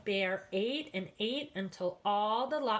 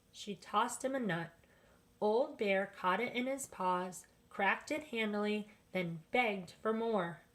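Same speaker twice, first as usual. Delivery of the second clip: usual speaking voice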